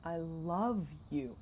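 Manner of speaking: "love" stretched long in a soft, slow "I love you"; stress on love. Shown as a demonstration. Host showing where the emphasis goes, stress on "love". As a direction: draw out "love" in "I love you", quietly. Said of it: "where you put the emphasis".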